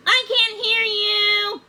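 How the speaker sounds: high, loud, slow wail; crying out. Acted. Frog refusing to listen to Puffin, wailing loudly. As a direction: drown them out, stretched and loud. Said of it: "John Bob cried".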